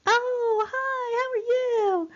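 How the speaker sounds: very high, drawn-out hello; mock high-pitched, slow gushing; Minnie Mouse-like greeting. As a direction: imitate women greeting in a squeaky high voice. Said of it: "speaking like Minnie Mouse".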